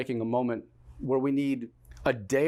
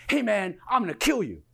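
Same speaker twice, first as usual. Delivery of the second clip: raised, higher mouthing off; mock threat; 7 semitones higher, 4 dB louder than usual. Stranger bumped on the street, loudly mouthing off. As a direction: imitate an empty street threat.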